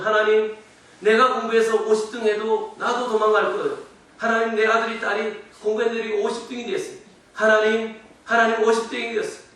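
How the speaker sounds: loud, high, pleading sermon voice; impassioned preaching. Real, not acted. Parent praying for a failing child, impassioned preaching. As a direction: cry out to God, building in force.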